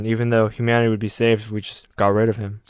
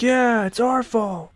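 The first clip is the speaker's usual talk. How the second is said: much higher, slow mock agreement; chorus agreeing; 11 semitones higher, much slower than usual. Everyone agreeing with Armin, much higher and slow. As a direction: drag out the agreement. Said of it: "And everyone's like".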